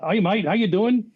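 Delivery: high, rough attempt at an Australian greeting; amateur Australian accent, lifted and lively. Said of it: "I don't do Australian accent real well".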